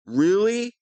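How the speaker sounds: mocking really. Villain mocks the rival's name, loud sarcastic sneer.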